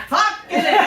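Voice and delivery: high, loud shouted curse; imitating the boss bellowing; loud as hell. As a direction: holler it across the restaurant. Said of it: "just loud as hell".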